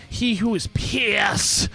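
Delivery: slow booming title; grand proclamation. Acted. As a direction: proclaim it like royalty.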